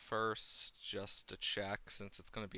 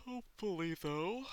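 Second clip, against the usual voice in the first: yawning through the words; high stretched yawn; 4 dB louder than usual. Real, not acted. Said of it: "Sorry about all the yawning".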